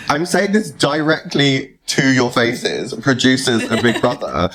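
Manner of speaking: ordinary delivery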